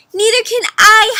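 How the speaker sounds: high, loud frightened howl. Acted. Flitter howls in fear in the dark. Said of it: "howled Flitter".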